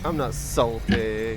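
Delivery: drawn-out denial. Acted.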